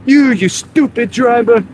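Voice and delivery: high-pitched yelling at a driver; road rage. Pretend. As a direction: screech at a bad driver. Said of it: "my road rage voice".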